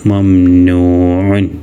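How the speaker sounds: മംനൂഉൻ said very slowly, long നൂ vowel stretched; teacher modelling മംനൂഉൻ slowly for pupils; slow Arabic reading. Shown as a demonstration. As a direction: draw out മംനൂഉൻ syllable by syllable, holding the long നൂ. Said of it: "മംനൂഉൻ എന്നാണ് കൂട്ടി വായിക്കുക".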